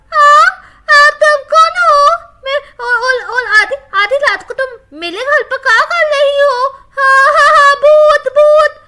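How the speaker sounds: high, loud, panicky yelling; frightened shout. Acted. Neeli yelling in panic at the ghost girl. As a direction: scream in panic at the stranger.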